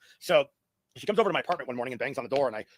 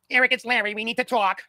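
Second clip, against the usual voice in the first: loud, higher East Coast landlord voice; Larry's East Coast accent; 8 semitones higher, 8 dB louder than usual. Retold or imitated. Landlord announcing himself at the tenant's door, in a loud East Coast accent. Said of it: "with this East Coast accent".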